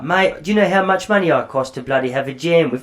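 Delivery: first go at a British rock star; British accent. Retold or imitated. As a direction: imitate a British rocker protesting. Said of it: "he's British, isn't he?".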